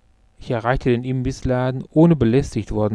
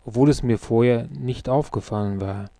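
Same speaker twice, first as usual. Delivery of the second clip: usual speaking voice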